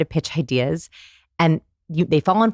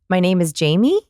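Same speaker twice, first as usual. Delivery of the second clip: "Jamie" swooping up like a question; question inflection. Retold or imitated. Retelling a founder's weak pitch opener, questioning rise. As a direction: ask the name instead of stating it. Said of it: "And he asked his name".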